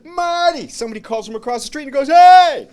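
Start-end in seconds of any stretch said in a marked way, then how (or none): 0.0-0.7 high shouted name; calling out. Retold or imitated. Stranger calls from across street. 2.1-2.7 loud high shout; shouting hey. Retold or imitated. Somebody calls across street.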